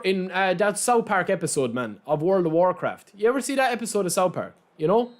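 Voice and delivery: ordinary talk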